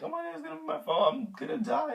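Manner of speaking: high-pitched, hurried squeal of dread; high squeaky retold panic; mock panic. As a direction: squeak it out fast, like a hammed-up panic. Retold fear of being kidnapped.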